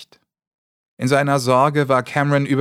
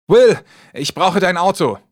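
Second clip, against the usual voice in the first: 1 urgent loud demand; 7 semitones higher, 6 dB louder than usual. Acted.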